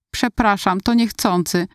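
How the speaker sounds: plain reading voice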